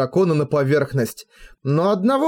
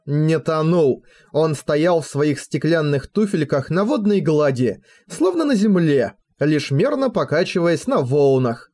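ordinary delivery

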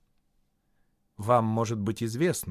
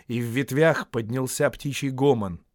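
plain reading voice

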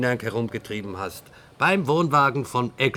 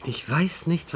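normal talking voice

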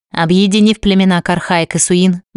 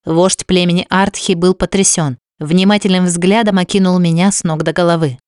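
ordinary delivery, nothing marked

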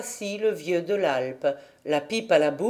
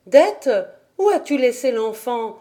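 calling out; 4 semitones higher, 4 dB louder than usual. A narrator's reading. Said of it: "lui criait-on".

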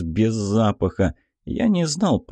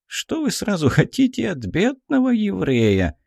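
put-on lilting Odessa accent with a raised pitch; 9 semitones higher than usual. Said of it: "с одесским акцентом сказал полковник".

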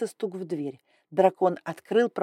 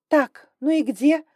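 slow, higher, louder indignation; indignant hiss; 8 semitones higher, 7 dB louder, slower than usual. Acted. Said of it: "возмущённо зашипела она".

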